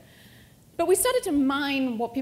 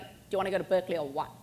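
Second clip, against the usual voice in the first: blunt mock ask; 4 semitones lower, faster than usual. Blunt question mocked.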